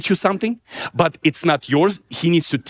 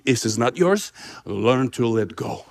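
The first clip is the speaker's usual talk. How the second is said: low, slow voice of God; slow down and counsel, low-pitched; solemn teaching; 8 semitones lower, 4 dB quieter than usual. Retold or imitated. God teaching him to let go.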